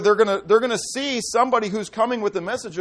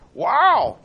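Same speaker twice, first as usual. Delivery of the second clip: awed wow; 3 semitones higher, 7 dB louder than usual. Real, not acted. Preacher reacts to verse.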